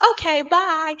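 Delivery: put-on high, sweet elderly auntie greeting; a fond imitation of a hundred-and-five-year-old aunt, high. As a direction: lift the voice up into a frail old auntie. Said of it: "She was like".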